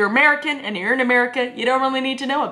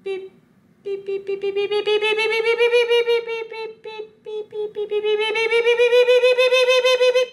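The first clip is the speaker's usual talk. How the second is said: very high monotone beeping, building in volume; imitated stud-finder beeps; 13 semitones higher than usual. Vlogger pretending to use a stud finder, frantic imitated beeping. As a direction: beep like a detector going wild. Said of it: "it goes beep".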